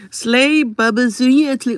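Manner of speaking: mocking name readout. Real, not acted. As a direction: stretch the username out to ridicule it. Reading a hater's username mockingly.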